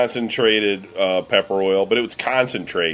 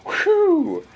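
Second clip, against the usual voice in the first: mock whew. Friend eats the hot pepper chip.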